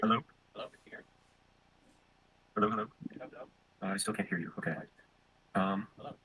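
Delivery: faint echo of the call; distant looped-back call audio; audio looping back. Real, not acted. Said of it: "my audio is looping back into your stream".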